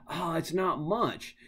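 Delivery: normal talking voice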